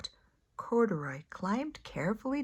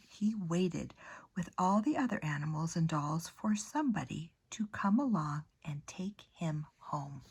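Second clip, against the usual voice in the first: gentle storybook narration, hushed at phrase ends; soft storytime voice; reading to children; 2 semitones lower, 4 dB quieter than usual. A narrator's reading. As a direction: read it like a bedtime picture book. Reads picture book to children.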